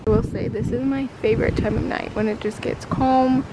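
usual speaking voice

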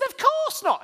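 put-on indignant kid. Son indignant reply.

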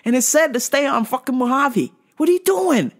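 exasperated scolding daughter. Retold or imitated. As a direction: yell at dad to follow the GPS. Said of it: "I was like".

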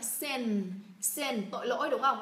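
ordinary talk, nothing marked

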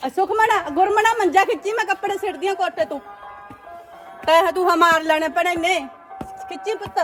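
high, carrying call across the yard. Real, not acted. Calling the children to pull the cot.

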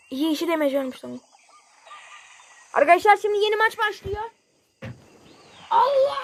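ordinary delivery

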